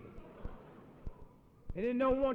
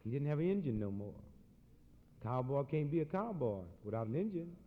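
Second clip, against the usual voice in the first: ordinary talk, nothing marked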